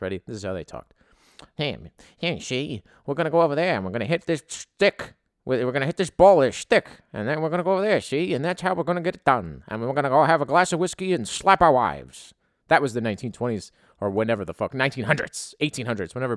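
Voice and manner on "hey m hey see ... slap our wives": louder, hammed-up old-timey tough guy talk; mock the early baseball men with a loud, brash "see?" voice; old-timey ballplayer. Host mocking how early baseball players talked, loud hammed-up old-timey voice. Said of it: "everybody talked like this".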